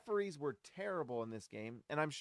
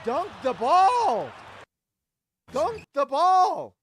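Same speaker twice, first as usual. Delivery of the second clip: high, slow, loud yell; exasperated yell; 11 semitones higher, 12 dB louder, much slower than usual. Real, not acted. Fan yelling at the replay of Kessler's miss, furious shout. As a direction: bellow it at the screen in disbelief.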